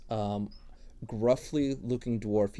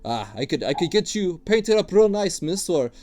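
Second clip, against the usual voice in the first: higher, louder wheedling dwarf voice; gruff dwarf tattooist; 5 semitones higher, 8 dB louder than usual. Acted. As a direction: sell your wares like an eager old dwarf. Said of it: "he looks at you and he goes".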